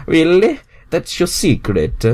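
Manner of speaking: Mario stunned; surprised Mario. Acted. Mario shocked by secret.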